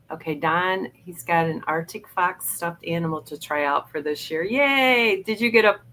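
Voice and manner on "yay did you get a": high, bright yay; excited cheer. Real, not acted. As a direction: cheer happily for a friend. Cheering a friend's new prop.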